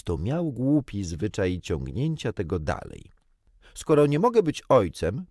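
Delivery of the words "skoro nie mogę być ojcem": loud, high-pitched earnest declaration; serious. A narrator's reading. Świstek asking for another role in the game, serious. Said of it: "powiedział poważnym tonem".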